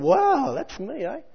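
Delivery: high, startled little wow; lightly hammed-up dismay; mock surprise. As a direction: act out a reader taken aback. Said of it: "I thought".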